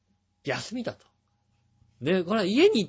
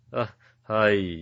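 low, slow, groggy phone answer; just-woken voice; 7 semitones lower, much slower than usual. Retold or imitated. Friend answering a call on Saturday, imitated just-woken voice. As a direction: imitate someone barely awake picking up. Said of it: "テンションの低い寝起きの声".

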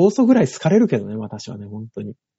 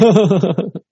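高めに弾ける短い笑い; 思わず吹き出すように; 笑い声; 5 semitones higher, 5 dB louder than usual. Real, not acted. 相手の冗談に笑う. (吹き出し笑い)

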